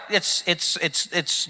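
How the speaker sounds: soft, halting repeats; imitated stammer. Comedian imitating an audience member lost for words, softly halting. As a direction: fumble for words under your breath. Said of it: "you were just like".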